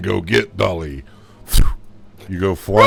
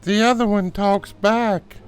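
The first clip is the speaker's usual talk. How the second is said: put-on high little girl remark; a small girl complaining about her doll.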